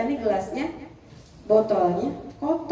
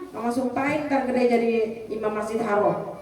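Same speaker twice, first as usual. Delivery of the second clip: usual speaking voice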